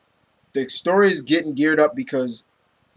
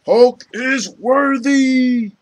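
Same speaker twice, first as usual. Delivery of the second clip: slow, high proclamation of Hulk worthy; dramatic announcement; 8 semitones higher, 5 dB louder, much slower than usual. Retold or imitated. Reviewer acting the big comic reveal, slow and high. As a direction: build the reveal like an epic trailer, drawn out.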